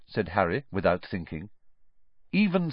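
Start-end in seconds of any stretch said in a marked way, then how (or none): none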